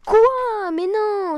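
high loud protest; indignant shout. Real, not acted. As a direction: yell it in disbelief. Another unfair death.

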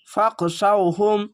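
ফাক শাউহুম joined and stretched slowly; slow joined recitation. Shown as a demonstration. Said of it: "এইটুকু একটু শব্দ করে পড়লাম". (ফাক শাউহুম ধীরে মিলিয়ে)

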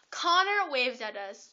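ordinary talk, nothing marked

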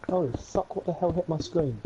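ordinary talk, nothing marked

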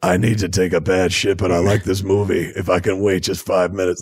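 lightly growled Batman inner thought; a hint of the gravel Batman voice on a silly thought; mock Batman voice. Mocking Batman's inner monologue in a light growl. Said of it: "does he think in that voice".

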